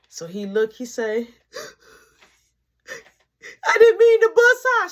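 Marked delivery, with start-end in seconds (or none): 3.7-4.9 high, loud whining excuse; a scared kid making excuses; whiny plea. Retold or imitated. Mark answering mama.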